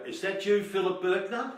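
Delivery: a hint of the friendly switchboard girl; lightly voiced operator; exchange girl.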